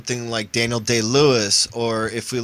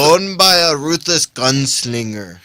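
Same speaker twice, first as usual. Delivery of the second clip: slow, louder caveman voice; 4 semitones higher, 6 dB louder, slower than usual. Pretend. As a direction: drag it out like a dumb brute. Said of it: "in a caveman voice".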